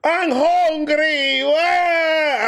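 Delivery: very high, slow bawl ending in a wail; hammed-up baby cry; mock crying kid.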